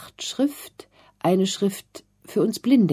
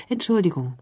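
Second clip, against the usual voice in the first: plain reading voice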